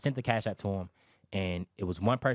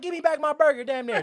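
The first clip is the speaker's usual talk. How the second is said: much higher and louder hammed-up indignation; mock outraged demand; 7 dB louder than usual. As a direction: shout it like an offended seller, half joking. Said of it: "the attitude he had".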